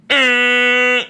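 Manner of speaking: long high air horn blare; one sustained, high-pitched honk; imitated air horn. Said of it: "blowing air horns".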